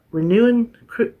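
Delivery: normal talking voice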